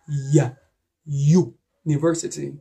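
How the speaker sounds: "university" begun with a consonant Y sound; consonant Y demo. Shown as a demonstration. Teacher showing students letter U as a consonant, sounded out in pieces. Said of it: "it is pronounced as a consonant".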